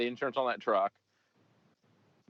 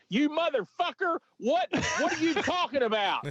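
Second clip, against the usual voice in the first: outraged shriek, much higher; furious high yell. Retold or imitated. Grateful mom turning on the officer, shrieking. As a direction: turn on him shrieking. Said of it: "it instantly went to".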